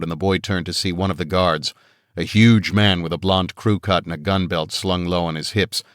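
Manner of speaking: plain reading voice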